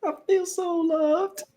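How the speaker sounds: high, slow, mock-weepy voice; mock teary. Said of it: "I'm about to cry".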